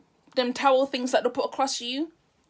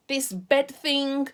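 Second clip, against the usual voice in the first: ordinary delivery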